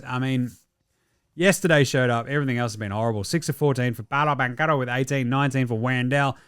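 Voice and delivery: ordinary talk, nothing marked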